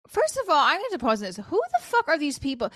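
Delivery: exasperated rant to the camera; exasperated outburst. Real, not acted. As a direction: blurt it out, fed up and baffled. Outburst at weird subscribers.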